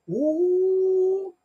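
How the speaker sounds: long, high, loud ooh; delighted ooh. Real, not acted. Admiring the shiny silver.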